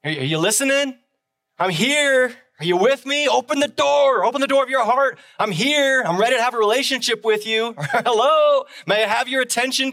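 preacher voicing Jesus calling out at the door of your heart. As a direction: knock and call, hoping someone answers. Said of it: "Jesus is basically saying".

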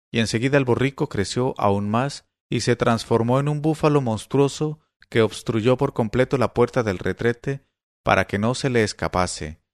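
plain reading voice

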